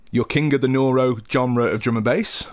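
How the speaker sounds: ordinary talk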